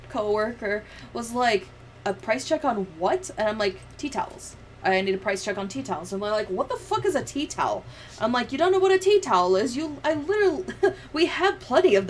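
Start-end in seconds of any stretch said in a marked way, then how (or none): none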